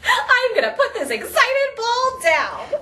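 high, drawn-out giggly delight; giddy excitement. Pretend. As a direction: stretch the words with giddy, high energy. Still acting excited with ball.

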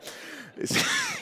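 high, wheezy chuckle; amused laugh. Real, not acted. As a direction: laugh at your own bad idea. Laughs at his abandoned accent idea.

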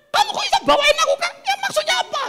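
very high, fast scolding; hammed-up loud shrill outrage; angry wife. Wife scolding husband over the gift, high angry outburst. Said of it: "istrinya marah-marah".

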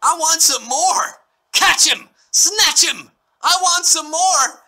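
high, slow, insistent demand; demanding Oliver. Retold or imitated. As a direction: plant your feet and demand it. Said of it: "He simply demands".

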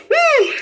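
loud-ringing revival "woo", pitched high; high whoop. Real, not acted. Preacher reacting to Hannah's lament, high whoop. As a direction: whoop between sentences.

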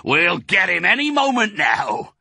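high, loud farmer's holler; full-voiced, high-pitched yell; shouted. A narrator's reading. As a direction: shout it across to the drivers. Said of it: "the fat Boggis shouted".

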